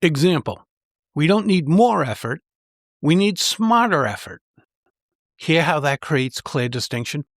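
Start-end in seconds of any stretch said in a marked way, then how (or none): 1.1-4.4 "more" and "smarter" each lifted high and punched; contrastive stress. Shown as a demonstration. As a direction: set "more" against "smarter" with strong stress on both. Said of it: "emphasize the contrasting words".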